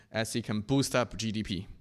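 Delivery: usual speaking voice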